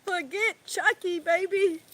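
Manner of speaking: high, loud burst of excitement; excited cry of forget Chucky; excited exclaim. Real, not acted. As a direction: blurt it out thrilled and loud. Excited exclamation after laugh.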